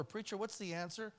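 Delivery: ordinary talk, nothing marked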